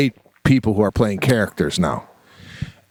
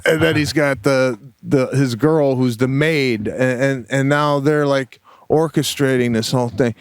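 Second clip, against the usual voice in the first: ordinary delivery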